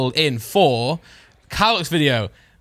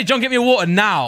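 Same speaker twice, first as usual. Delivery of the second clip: higher-pitched, mildly exasperated mock order; mock blunt demand; 7 semitones higher, 3 dB louder than usual. Co-host showing how to ask for water, blunt.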